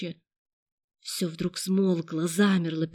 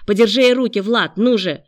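loud, impatient order; raised voice, pressing; urgent command; 3 semitones higher, 10 dB louder than usual. A narrator's reading. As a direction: call out for help in a struggle. Attacker calls accomplice for help.

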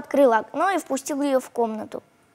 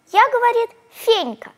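put-on little girl voice; 9 semitones higher, 3 dB louder than usual. A narrator's reading.

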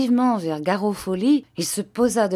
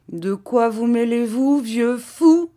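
loud, raised-pitch angry challenge; Garofoli, changed tone; 6 semitones higher, 6 dB louder than usual. A narrator's reading. Padrone turning on the meddling old master, angry. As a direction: drop the sweetness, lash out. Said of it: "changeant de ton".

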